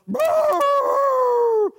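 high, long, held dog bay; bay like a treeing hound; imitated hound howl. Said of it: "the dog would go".

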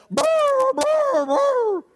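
series of high hound bays; bay excitedly along with the dog; imitated joint barking. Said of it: "we'd both growl, bark together".

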